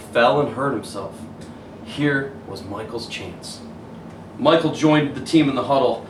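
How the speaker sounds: plain reading voice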